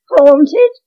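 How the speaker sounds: high, loud incredulous shriek of a word; shrieking disbelief. A narrator's reading. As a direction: cleaner laughs off haunting, shrieking in disbelief. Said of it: "She gave a shriek of laughter".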